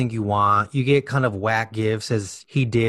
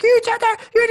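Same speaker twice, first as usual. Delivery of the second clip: scolding mom; 3 dB louder, faster than usual. Retold or imitated. Kid quoting mom scolding.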